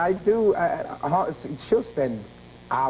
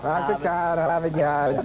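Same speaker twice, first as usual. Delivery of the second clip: the classic Boston line, r's dropped, lightly done; Boston accent. Shown as a demonstration. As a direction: drop the r's Boston-style, car and Yard open and long. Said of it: "You think I have a bad accent?".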